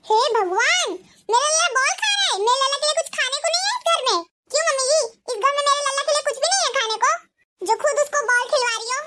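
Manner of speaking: a bossy bahu telling off her mother-in-law; shrill daughter-in-law. Acted. Daughter-in-law Ekta voiced by same speaker. (सास को डाँटती तेज़ बहू, ऊँची आवाज़ में)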